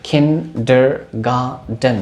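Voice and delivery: kindergarten in four slow separate beats; syllable by syllable. Shown as a demonstration. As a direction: break kindergarten into kin-der-gar-ten. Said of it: "चार syllable वाले words".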